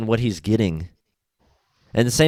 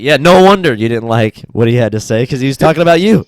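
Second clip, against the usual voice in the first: louder, punchy comeback; mock loud retort; 2 semitones higher, 9 dB louder than usual. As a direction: throw it back at the commenter, loud. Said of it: "And you're like".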